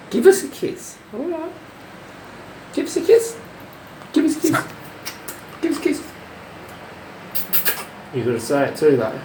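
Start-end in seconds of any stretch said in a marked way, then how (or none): 0.1-6.1 very high sing-song voice to the bird; high pet talk. Acted. Owner coaxing lorikeet for a kiss, squeaky high.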